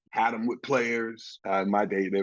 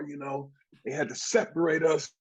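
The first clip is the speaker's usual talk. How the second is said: usual speaking voice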